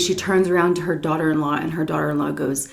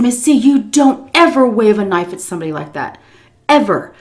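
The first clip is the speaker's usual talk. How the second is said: louder, emphatic reprimand; stern scolding; 4 semitones higher, 6 dB louder than usual. Retold or imitated. Storyteller scolding Miss C for waving a knife, stern. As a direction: lay down the law, punching EVER.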